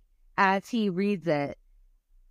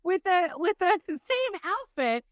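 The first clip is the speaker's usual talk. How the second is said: high, sheepish voice for the husband; 12 semitones higher than usual. Retold or imitated. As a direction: play the husband realizing his mistake. Husband's sheepish discovery, far voice.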